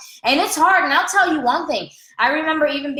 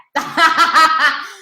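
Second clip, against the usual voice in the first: real laugh; 3 semitones higher, 6 dB louder than usual. Real, not acted. Laughs at own joke.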